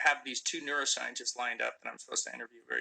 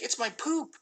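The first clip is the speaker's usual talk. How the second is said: put-on high, proud kid voice with a rising finish; a toddler proudly showing off his achievement; proud little son; 12 semitones higher than usual. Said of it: "And he's like".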